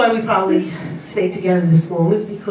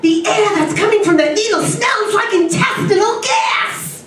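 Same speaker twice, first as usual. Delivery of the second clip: scream; 9 semitones higher than usual. Retold or imitated. Acupuncturist jumping back from the needle, screaming. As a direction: yelp it in shock. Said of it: "he screams".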